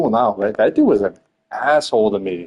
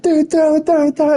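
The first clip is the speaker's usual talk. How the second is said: high-pitched mock urging, pitched way up; imitated urgent shouting; 4 dB louder than usual. John urging a teammate to throw it, imitated in a high, urgent voice. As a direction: squeal it like a panicked teammate.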